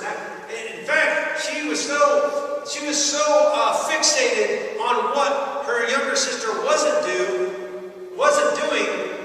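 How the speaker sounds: rising, slow and loud preaching climb; stretched, loud, climbing pulpit delivery; preaching at full force. Real, not acted. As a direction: build the point like a revival preacher.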